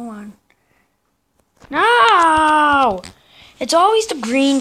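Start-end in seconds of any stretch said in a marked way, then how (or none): 1.7-3.0 long, loud, high no; drawn-out dismayed yell. Real, not acted. Player beaten by the green team, dismayed yell. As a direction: stretch it into a loud protest.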